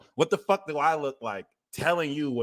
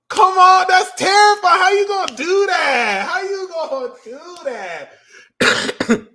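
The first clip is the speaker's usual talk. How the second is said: very high, loud exclamation into laughter; exclaiming; 9 dB louder, slower than usual. Real, not acted. Squealing at Aquarius, then laughing.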